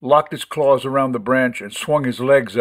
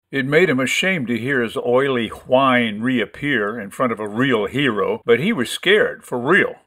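ordinary delivery, nothing marked

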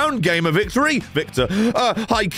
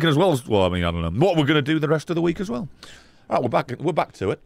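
ordinary talk, nothing marked

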